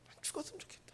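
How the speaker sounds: unvoiced despairing whisper. Preacher voicing a weary person wishing to die. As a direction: breathe it out hopelessly. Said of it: "힘이 든 사람은요".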